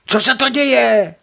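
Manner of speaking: high, loud drawn-out shout; calling out. A narrator's reading. Running along the riverbank to the angler, calling out. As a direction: call it from afar, stretching the words. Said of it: "Běžel po břehu a volal".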